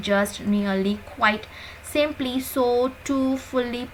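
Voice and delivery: usual speaking voice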